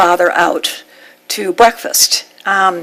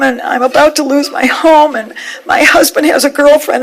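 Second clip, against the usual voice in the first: much higher, tearful rush of words; crying plea; 5 semitones higher than usual. Retold or imitated. She sobs her troubles onto the fishmonger's shoulder, high tearful outpouring. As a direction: pour out every trouble at once, half sobbing. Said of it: "and I said".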